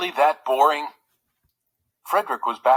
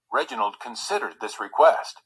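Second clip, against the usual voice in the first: ordinary delivery, nothing marked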